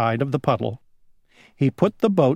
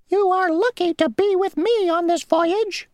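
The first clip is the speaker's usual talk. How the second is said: very high, squeaky and self-important; a tiny insect bragging; the mosquito bragging to the grasshopper; boastful mosquito. Acted. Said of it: "said the mosquito".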